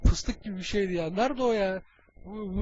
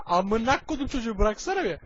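ordinary delivery

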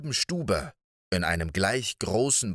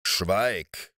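angry giant. Acted. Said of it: "rief der Riese zornig".

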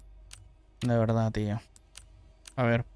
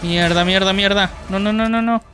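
frustrated cursing; 5 semitones higher, 4 dB quieter than usual. Real, not acted. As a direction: blurt out angry curses at the game. Player dies in game. (maldiciendo con rabia)

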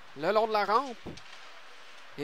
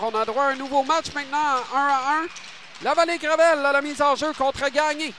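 excited; 3 semitones higher, 5 dB louder than usual. Real, not acted. Game tied, restart.

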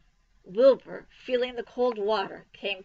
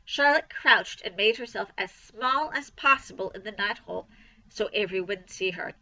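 ordinary delivery

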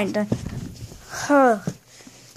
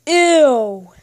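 loud, drawn-out disgusted reaction; play-acted yuck; 5 dB louder than usual. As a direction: recoil in disgust, loudly. Reacting to hot sauce on cereal.

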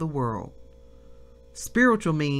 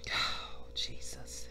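breathy whispered oh Jesus, much softer; whispered prayer; 14 dB quieter, much slower than usual. Real, not acted. Preacher overcome, whispering a prayer to Jesus.

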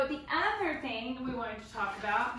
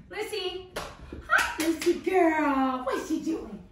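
put-on high, louder gushing baby talk; excited baby voice; 5 semitones higher, 6 dB louder than usual. Shown as a demonstration. Trainer testing the dog's sit, high baby voice. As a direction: greet her like she's the best dog ever. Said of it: "I'm talking in the baby voice".

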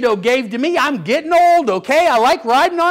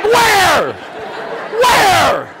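startled reply; 5 semitones higher than usual. Retold or imitated. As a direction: call out in mock alarm. Startled reply to child's holler.

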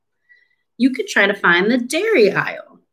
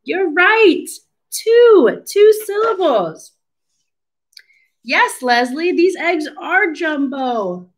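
much higher, bright cheering; excited praise; 9 semitones higher than usual. Real, not acted. Teacher cheering a child's right answer, high and excited.

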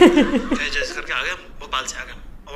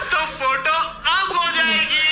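slow, high, laughing line; 8 semitones higher, slower than usual. Retold or imitated. Stepping in to cover the photo, slow and laughing high. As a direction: drag the words out while cracking up.

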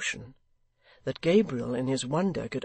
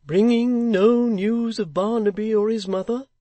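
higher, louder doubtful question; dubious; 9 semitones higher, 9 dB louder than usual. Acted. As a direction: ask it half expecting no. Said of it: "said the locksmith dubiously".

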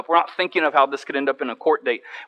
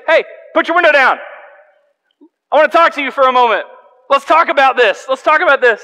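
raised, higher voice asking him to talk; calm, friendly call; 9 semitones higher, 6 dB louder than usual. Retold or imitated. Pastor calling to an angry driver, friendly and raised. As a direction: coax him to roll the window down. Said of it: "So I say".

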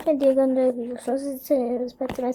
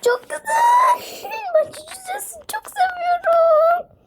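high delighted cry, slowing down; excited squeal. Real, not acted. Gamer gushing over a wallet, high excited squeal. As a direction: squeal with delight, then linger.

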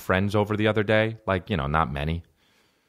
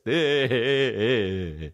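long goofy mock giggle; imitated laugh. Buddy laughing after offering his playlist, imitated giggle. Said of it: "He laughs at everything".

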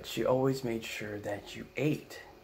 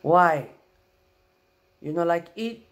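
put-on loud, high accented demand; mad Filipino grandma; 7 semitones higher, 12 dB louder than usual. Angry grandma pushing grandson to eat, in a Filipino accent. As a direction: insist on eating, offended. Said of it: "she gets mad".

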